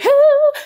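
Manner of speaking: high, loud sudden laugh; burst of laughter. Real, not acted. Teacher cracking up at saying [name], loud laugh. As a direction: crack up mid-sentence. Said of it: "I'm sorry".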